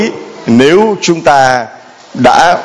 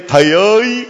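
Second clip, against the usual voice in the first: calling out; 7 semitones higher than usual. Acted. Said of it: "phải giơ tay lên".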